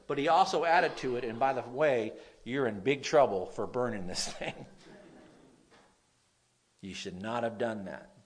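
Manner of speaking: usual speaking voice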